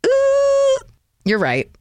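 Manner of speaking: sudden realization. Retold or imitated. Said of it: "And I was like".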